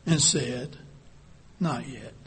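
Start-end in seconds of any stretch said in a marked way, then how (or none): 1.6-2.1 soft, low and smiling; steady the teacup kindly; gentle. Acted. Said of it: "He smiled gently".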